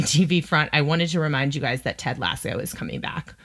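ordinary delivery

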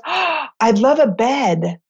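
put-on awed, happy girl's voice; delighted little girl. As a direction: imitate a child's smiling wonder. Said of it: "gets this big smile on her face".

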